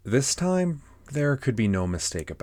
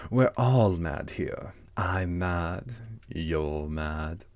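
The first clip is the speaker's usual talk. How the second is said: slow, drawn-out cat voice; Cheshire Cat; much slower than usual. Acted. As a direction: stretch the words out like a leisurely storybook cat. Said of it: "said the cat".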